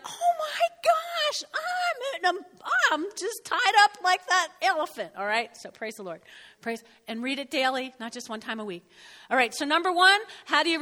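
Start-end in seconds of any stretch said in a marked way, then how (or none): 0.1-5.1 squealed high, half laughing; mock panic. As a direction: shriek in mock alarm, then settle. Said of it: "And you're like".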